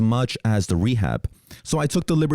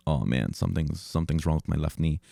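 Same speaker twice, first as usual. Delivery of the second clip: softer, lower, worried under the breath; realizing the knee is hurt, low and soft; dismayed mutter; 6 semitones lower, 6 dB quieter than usual. Retold or imitated. Injured, standing up after fall.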